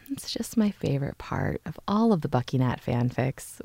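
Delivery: whispered, swooning softly; host gushing about her favourite fanfic moment, in a whisper; dreamy whisper. Real, not acted. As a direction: breathe it out like a secret crush. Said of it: "you can hear how my eyeballs have".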